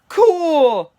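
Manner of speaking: loud, high, drawn-out cool; whooping crowd reaction; crowd cheer. Acted.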